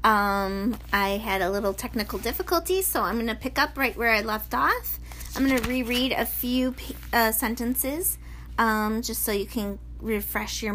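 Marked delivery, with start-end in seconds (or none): none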